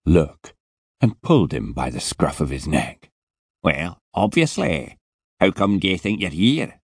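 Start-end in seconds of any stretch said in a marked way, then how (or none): 3.6-6.8 put-on heavy Scottish accent, impatient and put out; a surly Scottish security man scolding; Scottish guard telling a boy to get up; grumpy Scottish guard. Scottish guard voiced in story.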